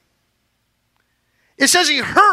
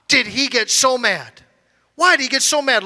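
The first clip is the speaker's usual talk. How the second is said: normal talking voice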